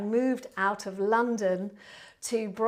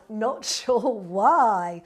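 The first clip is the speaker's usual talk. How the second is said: laughing through the words; slow, amused laugh mid-line; 4 dB louder, much slower than usual. Real, not acted. Reading a funny chat message.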